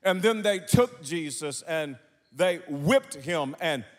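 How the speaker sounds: high, loud chanted preaching; full-force preaching. Acted. Preacher chanting Jesus' suffering to congregation, loud fervent whoop. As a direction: build the congregation's fervor.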